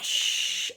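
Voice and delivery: long airy rushing noise; imitated whoosh. Storyteller as the roller coaster drops, rushing whoosh. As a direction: swoosh like a speeding ride.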